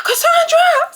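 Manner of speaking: very high, loud acted scream; retold scream. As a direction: scream a friend's name as she falls. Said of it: "I'm screaming".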